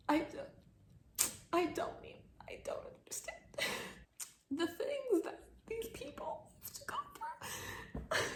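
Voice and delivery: high, breathless whispered sobbing; choked tearful whisper; crying whisper. Real, not acted. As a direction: barely get it out through tears. Crying while describing book.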